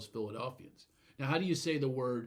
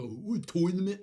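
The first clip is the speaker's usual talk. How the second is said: high, loud mocking Philly imitation; 6 semitones higher, 6 dB louder than usual. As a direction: ham up the outsiders' mockery. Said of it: "when they imitate us, they'll go".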